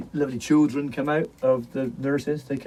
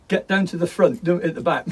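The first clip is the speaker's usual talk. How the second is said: raised, scolding teacher voice; stern teacher; 7 semitones higher, 6 dB louder than usual. Retold or imitated. English teacher scolding pupils at the back, raised voice. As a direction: order the boys to the front.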